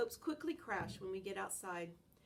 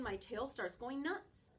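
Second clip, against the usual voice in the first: ordinary delivery, nothing marked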